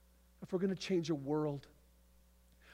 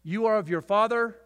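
accusing proclamation; 8 semitones higher, 10 dB louder than usual. Acted. Jesus confronting Pharisees.

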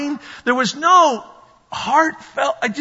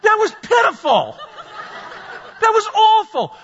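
higher, laughing through each line; laughing retort; 10 semitones higher than usual. Retold or imitated. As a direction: laugh while scolding. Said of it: "I wanted to say".

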